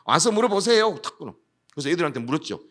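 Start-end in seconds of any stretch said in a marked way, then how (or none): 0.1-1.0 loud, higher curt order; retold police brush-off; curt detective. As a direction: bark it and hang up. Detective curtly hangs up.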